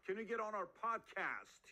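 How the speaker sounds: high-pitched mock eagerness; hammed-up fan pleading, pitched high; eager guest-chaser. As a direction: call out to a star you are begging to book. Acting eager beginner chasing celebrity guests.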